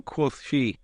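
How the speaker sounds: plain reading voice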